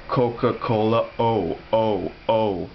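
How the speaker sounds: "Coca-Cola" with gliding English o, then "oh" isolated; English diphthong. Shown as a demonstration. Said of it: "that's an English diphthong".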